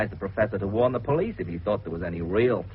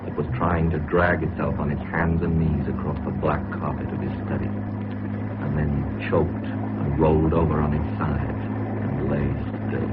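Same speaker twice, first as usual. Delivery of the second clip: grim story narration; 8 semitones lower than usual. A narrator's reading. Narrator recounting the dying professor, low and ominous. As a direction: tell the grim ending gravely and deliberately.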